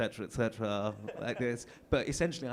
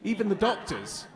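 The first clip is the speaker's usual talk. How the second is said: normal talking voice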